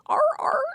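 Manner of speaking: high barking seal calls; seal barks. Acted. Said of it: "what these two seals are thinking".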